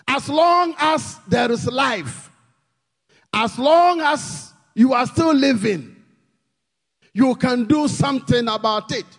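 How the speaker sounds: urgent preacher's call; full-force preaching. Real, not acted. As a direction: preach it out over the music. Preaching climax, hope.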